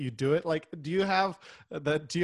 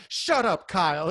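pissed-off mom; 3 semitones higher, 5 dB louder, slower than usual. Retold or imitated. Mom back from selling snaps at her son, mock pissed. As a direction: imitate an irritated mother telling off her kid. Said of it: "she get all pissed".

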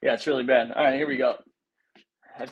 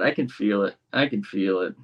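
normal talking voice

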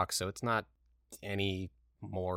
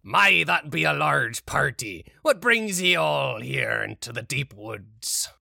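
loud pirate greeting; pirate captain; 3 semitones higher, 8 dB louder than usual. Acted. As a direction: hail them like a pirate captain. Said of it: "he's like".

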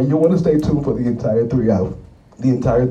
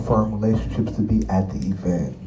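usual speaking voice

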